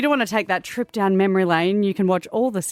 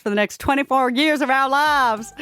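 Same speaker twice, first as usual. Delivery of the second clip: higher, strained attempt at a Texas accent; self-admittedly bad Texas accent; 6 semitones higher than usual. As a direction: push a Texas coach accent and overshoot it. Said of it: "I can't do that accent".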